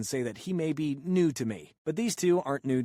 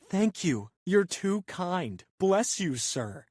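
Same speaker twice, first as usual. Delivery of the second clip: higher, sugary, overdone thanks, slow on "too kind"; hammed-up gratitude; 7 semitones higher, much slower than usual. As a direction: lay the thanks on thick to rub it in. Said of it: "really hamming it up".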